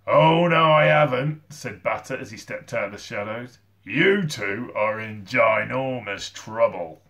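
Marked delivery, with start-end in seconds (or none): 0.1-1.5 very loud, higher, drawn-out retort; menacing villain. A narrator's reading. Batter stepping from the shadows, booming menace. 3.8-7.0 slow, drawn-out threat; threatening villain. A narrator's reading. Batter to Eric and Sid, threatening.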